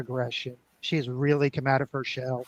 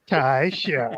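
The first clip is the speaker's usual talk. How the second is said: overdone catchphrase; 6 dB louder than usual. Pretend. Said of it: "everyone's doing their Tai Chars".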